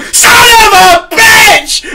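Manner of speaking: high, slow, loud pained shout; loud yell. Retold or imitated. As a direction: bellow it like you just got hurt. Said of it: "And I yell it so fucking loud".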